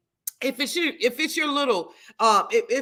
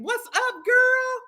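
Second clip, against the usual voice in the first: excited greeting; 13 semitones higher, much slower than usual. Real, not acted.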